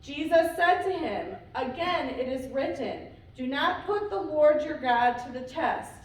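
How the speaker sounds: normal talking voice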